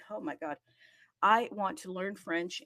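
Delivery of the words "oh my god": ordinary talk, nothing marked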